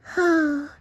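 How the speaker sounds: long high cartoon sigh. A narrator's reading. SpongeBob sighing after being fired, high sigh. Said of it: "he sighed".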